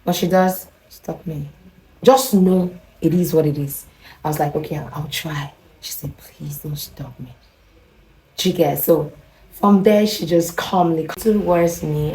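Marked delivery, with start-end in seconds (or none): 4.6-5.5 soft, retold agreement; meek reply. Visitor gives in to the neighbor, softly. 6.2-7.4 slow, breath-only whispered plea; intimate whisper. Retold or imitated. Neighbor pleads with her visitor, slow whisper.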